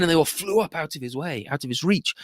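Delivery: usual speaking voice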